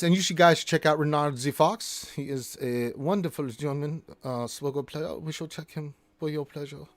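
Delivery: badly faked German accent; hammed-up, bad German accent; horrible German accent. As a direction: read it out in a mock German accent. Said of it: "I do this horrible German accents".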